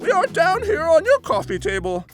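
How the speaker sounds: put-on high, grand old lady; regal elderly voice. Old lady car corrects the man politely, regal elderly voice. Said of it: "the regal elderly voice says".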